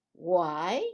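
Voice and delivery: usual speaking voice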